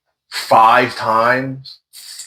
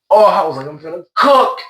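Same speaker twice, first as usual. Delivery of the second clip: excited; 9 semitones higher than usual. Retold or imitated. Retold hype over loot.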